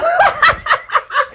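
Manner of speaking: high laugh at his own joke; burst of laughter. Real, not acted. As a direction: laugh out at the surprise.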